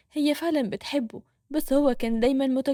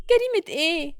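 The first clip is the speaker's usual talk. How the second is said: higher startled question; shocked question; 5 semitones higher than usual. Acted. Nour shocked by Yassin's accusation, higher startled question. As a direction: caught off guard. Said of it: "بصت له بصدمة".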